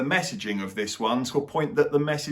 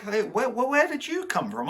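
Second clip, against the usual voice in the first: mock surprise; 9 semitones higher than usual. Mock surprised reaction to the actor.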